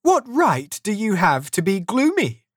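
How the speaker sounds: high, lively teasing question; cheerful retort. A narrator's reading. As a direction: turn the question back cheerfully. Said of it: "the nephew replied cheerfully".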